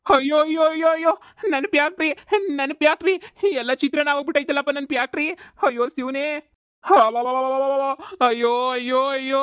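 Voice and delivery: high, loud wail over the ruined factory; comic wailing, high and loud; wailing lament. Acted. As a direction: cry out to the heavens in a hammed-up lament. Owner finds his factory destroyed.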